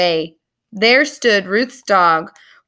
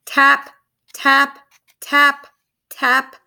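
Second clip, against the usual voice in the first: light, higher, crisp taps; tapping sounds; 4 semitones higher, much slower than usual. A narrator's reading. A chick tapping at her egg wall, light taps. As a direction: tap it out like a tiny beak.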